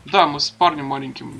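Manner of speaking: ordinary talk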